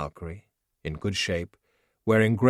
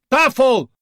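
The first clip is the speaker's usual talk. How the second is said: much higher, much louder call; shout from afar; 12 dB louder than usual. Acted. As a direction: yell his name across the village. Voice yelling from hut.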